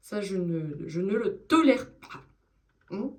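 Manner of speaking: mère stricte qui martèle lentement, voix qui monte; daronne chiante. Pretend. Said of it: "je suis la, la daronne chiante". (maman autoritaire, ton appuyé et théâtral)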